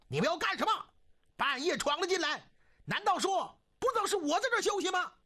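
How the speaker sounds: high, indignant official demanding answers; high-pitched outraged challenge; indignant scolding. A narrator's reading. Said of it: "大骂起来".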